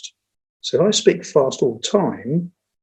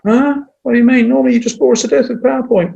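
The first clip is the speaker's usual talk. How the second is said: high, loud incredulous heckle; mocking audience member; 10 semitones higher, 3 dB louder than usual. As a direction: play a cheeky listener scoffing at the boss. Audience voiced mocking the speaker.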